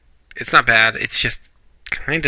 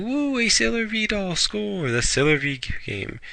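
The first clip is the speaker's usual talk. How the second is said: much higher, gleeful mock cheer, softer; happy cheer; 11 semitones higher, 5 dB quieter than usual. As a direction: squeal with delight over the prize. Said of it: "They both get happy".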